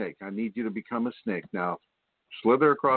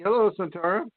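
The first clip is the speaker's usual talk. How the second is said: ordinary talk, nothing marked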